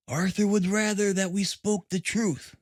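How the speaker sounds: steady, firm answer; lightly voiced firm young woman; firmly. A narrator's reading. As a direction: hold her ground calmly. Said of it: "the girl answered firmly".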